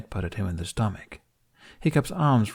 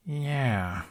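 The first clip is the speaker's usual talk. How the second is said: slow; 4 semitones higher than usual. A narrator's reading. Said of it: "Hiccup said, slowly".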